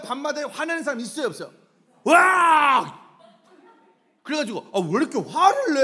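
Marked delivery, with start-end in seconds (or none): 2.0-3.1 explosive high yell; angry outburst. Acted. Preacher imitating someone losing their temper, angry yell. Acts out household anger outburst. 4.7-5.8 loud, raised-pitch mock reproach; exasperated complaint. Preacher acting a relative asking why so angry, exasperated. Acted family quarrel.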